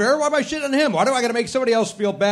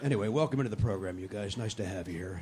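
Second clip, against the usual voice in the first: hushed welcome; 10 semitones lower, 12 dB quieter than usual. Real, not acted. Repeated intro, dropped voice.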